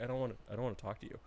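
sulky dog voice. Retold or imitated. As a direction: voice the offended dog, brushing him off. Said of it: "she was upset".

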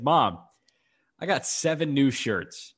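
ordinary talk

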